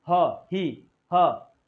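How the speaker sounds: ह said with a strong breathy h, then ही; h sounds. Shown as a demonstration. Teacher drills the breathy h sound for students. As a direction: breathe out a clear h on ह. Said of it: "एच pronounce".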